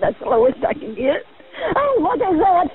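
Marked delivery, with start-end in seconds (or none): 1.8-2.7 sudden high frightened cry; real alarm at an unknown noise; startled fear. Real, not acted. Sudden scare in burning house.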